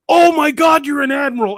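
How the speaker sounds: put-on a stunned young soldier yelling in surprise; shocked realization. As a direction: blurt it out in alarm. Realizing he met an admiral.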